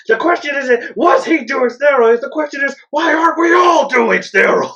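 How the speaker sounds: imitated angry comic rant, high shouting. Quoting an angry comedian's bit to co-host, ranting shout. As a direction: build to an exasperated, hammed-up yell. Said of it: "he was the angry dude".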